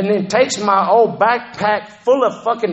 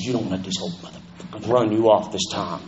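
put-on lower, softer voice of a stern older woman; old lady; 10 semitones lower, 7 dB quieter than usual. Old lady warning him not to quit, lower and softer. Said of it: "this old lady came up to me".

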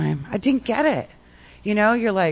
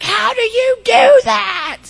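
much higher, louder and slower, squeaky disbelief; incredulous; 8 dB louder, slower than usual. Retold or imitated. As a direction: act stunned at the impossible advice. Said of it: "And I was like".

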